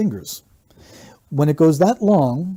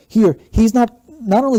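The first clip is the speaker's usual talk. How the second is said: emphatic point; 8 semitones higher, 8 dB louder than usual. Real, not acted. Analyst stressing point.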